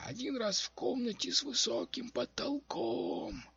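high, slow, strained crone voice; old witch prophesying. A narrator's reading. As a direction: choke out each word of the prophecy. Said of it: "с трудом выталкивая каждое слово, давясь каждым слогом". (пророчество старой ведьмы, с трудом)

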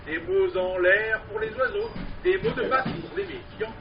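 lively recited street poem; singsong market cry. Acted. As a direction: perform it like a street barker. Performed poem with refrain.

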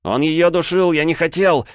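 high, loud desperate yell; desperate shouting. Acted. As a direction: yell the excuse in panic. Desperate plea while restrained.

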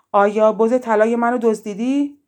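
witch's loud interrogation; shouted accusation. A narrator's reading. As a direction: call the accusation out across the water. Witch shouts accusation across lake.

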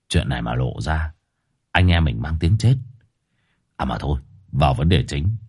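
low, easygoing gang boss talking down. Acted. Boss tells his mates to keep it secret, low and easy. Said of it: "cười cười lắc đầu".

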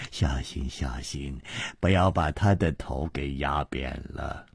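low, hushed, sinister coaxing; soft menace. Acted. Villain directs henchman's hands onto hostage, soft menace. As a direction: murmur it with mock care.

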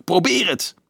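high-pitched defiant cry; defiant call. A narrator's reading. Carolus dares the riders as he raises his bow, defiant cry. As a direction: call out a challenge, pitch leaping up. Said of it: "Carolus riep".

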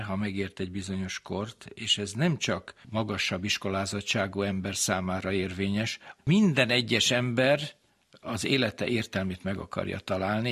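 normal talking voice

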